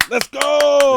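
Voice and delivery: big excited yell; hype shout. Real, not acted. Hype podcast intro shout.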